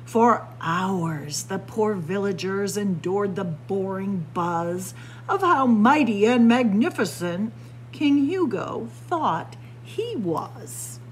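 usual speaking voice

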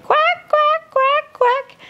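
very high squeaky duck quacks. Acted. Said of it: "Mother duck said".

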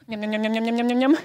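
loud chewing noises. Acted. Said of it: "so laut geschmatzt".

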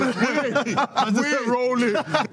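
stretched slow, laughing through it; laughing mock. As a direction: drag it out, cracking up. Mocking the rollie claim.

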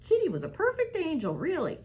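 Granny loudly shouting her relief; high shouted exclamation. A narrator's reading. Granny shouts her relief high and loud. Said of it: "she shouted".

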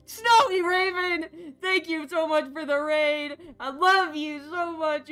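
gushing thanks. Real, not acted. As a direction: gush over the raider.

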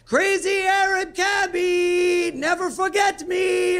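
very high, slow, stretched-out holler; cabbie's window yell. Retold or imitated. Cab driver blasting music, window down, imitated crazy holler. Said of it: "rolling down the window going".

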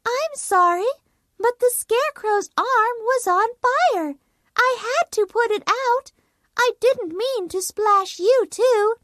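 put-on a child explaining she meant no harm; little girl apologising. Dorothy apologises to the witch, high and hurried.

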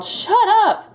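very high, loud squeaky mouse voice; mouse's snarl. A narrator's reading. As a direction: snap it as a tiny angry mouse. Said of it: "Mouse snarled".

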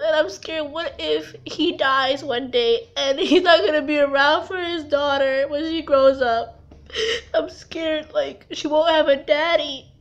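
scared, tearful worry; crying. Real, not acted. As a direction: talk through real sobs. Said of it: "I'm sorry for crying".

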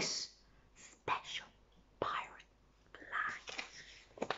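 drawn-out hushed whisper. Shown as a demonstration. Teller whispering the clue to the children. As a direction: whisper each word like a secret. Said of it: "That's right".

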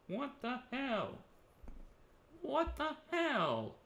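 slow, stunned disbelief; drawn-out disbelief. Real, not acted. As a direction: drag out each word, baffled. Stunned reaction in game.

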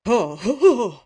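loud, high, blustering ho-ho; acted derisive laughter, loudly; scornful laugh. A narrator's reading.

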